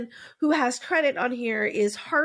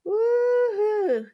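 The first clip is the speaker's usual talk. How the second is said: excited; 12 semitones higher, 3 dB louder than usual. Real, not acted. Cheer after greeting.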